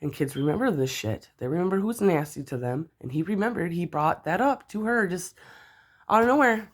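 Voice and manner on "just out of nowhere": indignant outburst. Real, not acted. Angry retelling of her son.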